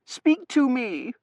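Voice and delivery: high, slow, loud plea; anxious pleading. Acted. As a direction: implore her slowly in a high voice. Daughter pleading with mother.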